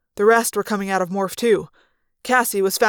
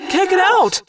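high, loud cry from a trapped fighter; alarmed cry; 10 semitones higher, 5 dB louder than usual. A narrator's reading. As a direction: cry out that you are trapped. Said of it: "Tobias cried".

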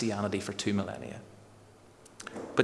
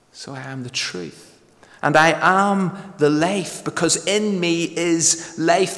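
preaching at full force; 6 semitones higher, 4 dB louder than usual. Acted. Preaches Jesus' words.

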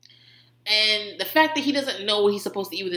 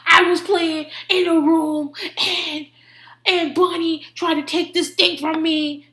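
high-pitched, forceful little-kid impression; exaggerated toddler tattling; imitating aggressive toddler; 8 semitones higher, 4 dB louder, slower than usual. Three-year-old reporting to mommy about Bunny, mock toddler voice. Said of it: "this three-year-old is always talking to me".

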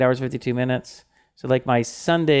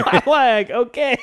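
laughing; slower than usual. Real, not acted.

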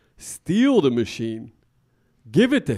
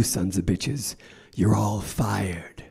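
menacing whisper; 5 semitones lower, 6 dB quieter, slower than usual. Acted. Boss whispering a threat to his sales team, menacing. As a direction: whisper it low and slow, a threat.

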